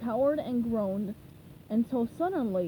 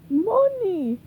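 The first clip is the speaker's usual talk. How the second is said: wheezed; 8 semitones higher, 9 dB louder than usual. A narrator's reading. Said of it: "he wheezed".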